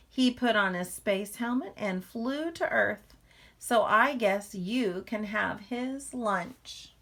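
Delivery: lively, expressive read-aloud to a class; reading to children. A narrator's reading. Teacher reading a picture book to the class, lively read-aloud. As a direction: draw young listeners into the story.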